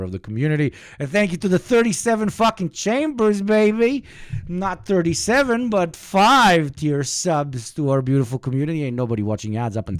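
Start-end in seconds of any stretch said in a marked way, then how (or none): 1.0-4.0 high, loud hype voice; hype shout-out. Real, not acted. Hyped thanks for subs. 4.5-8.8 hype shout-out. Real, not acted. Hyped thanks for subs.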